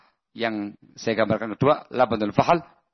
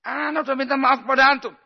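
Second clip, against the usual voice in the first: much higher, louder and fast, aggrieved complaint; indignant protest; 13 semitones higher, 4 dB louder, faster than usual. Pretend. Apologiser snaps back at the angry friend, indignant and high. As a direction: protest that you already apologised, voice rising. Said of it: "Jangan mengatakan".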